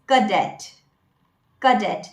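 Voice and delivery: "cadet" as kuh-DET; correct pronunciation. Shown as a demonstration. Coach introducing the word, correct pronunciation. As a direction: say "cadet" with a weak first syllable, kuh-det. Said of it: "This is the pronunciation".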